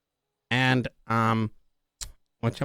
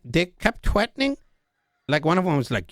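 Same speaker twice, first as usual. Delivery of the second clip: ordinary talk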